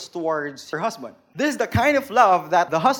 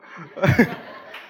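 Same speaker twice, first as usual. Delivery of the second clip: laugh. Real, not acted. Laughs after the bro line.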